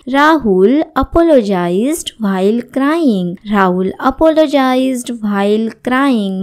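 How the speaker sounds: slow, clear classroom reading; slow model sentence. Shown as a demonstration. Teacher reading the example to students, slowly. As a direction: spell the sentence out for learners. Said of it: "teacher models English sentence".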